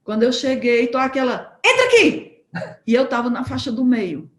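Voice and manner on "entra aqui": high, loud sudden yell; sudden shout. Retold or imitated. Friend yelling to take the exit, sudden shout. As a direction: yell the turn at the last moment.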